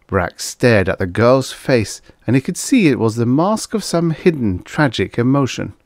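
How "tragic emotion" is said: usual speaking voice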